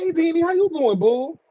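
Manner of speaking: high, playful mock-friendly hello; teasing greeting. Host teasing a riled-up caller, playful mock greeting. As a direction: greet the angry caller in a high sing-song.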